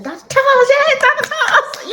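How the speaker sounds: high, loud, giddy gushing with a laugh; gushing fan. Retold or imitated. Mimicking someone who hears her praise the doctor, gushing. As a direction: squeal the name like a starstruck fan. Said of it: "you'll be like".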